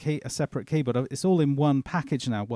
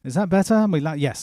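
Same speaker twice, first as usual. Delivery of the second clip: louder, higher, projecting voice; speaking up; 3 semitones higher, 6 dB louder than usual. Real, not acted. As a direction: raise your voice to be heard. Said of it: "Why are we so quiet?".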